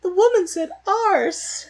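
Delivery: very high, loud, slow scandalised squeal; shocked posh lady. Retold or imitated. Shocked English lady at the races, scandalised squeal. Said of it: "she faints".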